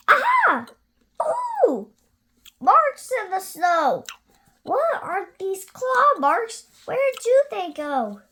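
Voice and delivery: high, loud excited little voice; excited child monster. Acted. Gruffalo's child spotting a trail, high and thrilled. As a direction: discover the trail with glee. Child finds claw marks.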